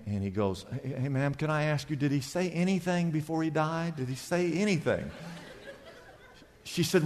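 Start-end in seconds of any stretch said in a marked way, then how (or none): 0.8-5.1 softly voiced tentative question; imitating a careful, hushed store owner; delicate asking. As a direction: ask gingerly, keeping the volume down. Owner delicately questions grieving customer.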